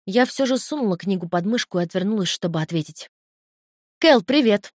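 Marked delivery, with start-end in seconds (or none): 4.0-4.7 higher, louder greeting; yelling into phone. A narrator's reading. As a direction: call out brightly down the line. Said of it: "заорала я в трубку". (громко, выше, в трубку)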